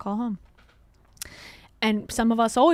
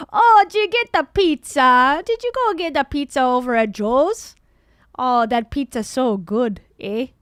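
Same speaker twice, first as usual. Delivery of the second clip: mock Chicago accent; 2 semitones higher, 8 dB louder than usual. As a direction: belt it out like a Chicago local gushing about pizza. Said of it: "That could be Chicago, too".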